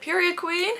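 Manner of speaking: high, hyped-up "Period, queen". Retold or imitated. Hyping up the heroine, a high cheer. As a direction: cheer her on like a bestie.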